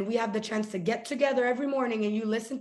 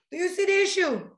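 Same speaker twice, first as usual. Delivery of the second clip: emphatic question; 8 semitones higher, 5 dB louder than usual. Real, not acted. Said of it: "repeated for emphasis".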